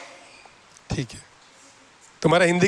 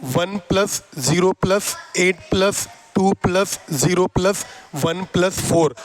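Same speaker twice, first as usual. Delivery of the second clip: slow counting; slower than usual. Shown as a demonstration. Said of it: "कर दो plus".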